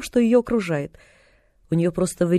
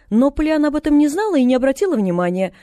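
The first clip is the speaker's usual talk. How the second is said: ordinary delivery, nothing marked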